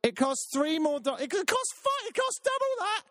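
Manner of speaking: rising falsetto rant about a ten dollar pint; high angry voice. Real, not acted. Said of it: "I've gone high voice".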